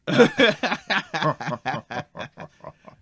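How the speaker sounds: loud, high burst of laughter; hearty laugh. Acted. Guide gives in to tourist's razorback retort, laughing loudly. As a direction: laugh long, tailing down from the top.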